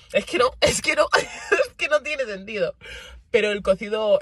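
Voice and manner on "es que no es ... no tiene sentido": laughing it out, pitch jumping higher; can't get the words out for laughing, voice breaking; laughing disbelief. Real, not acted. Vlogger laughing to her viewers about food.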